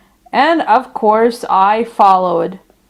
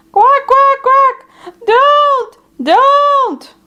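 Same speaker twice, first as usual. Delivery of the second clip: frightened ducklings; 13 semitones higher, much slower than usual. Acted. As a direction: squawk out in fright. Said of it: "frightened them so much that they called out".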